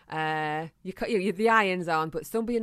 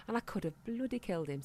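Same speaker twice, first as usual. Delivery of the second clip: ordinary talk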